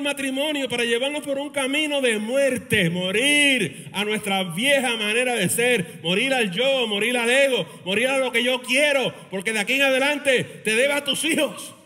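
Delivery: full-force pulpit preaching; fiery preaching. Acted. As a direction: drive it home like a revival sermon.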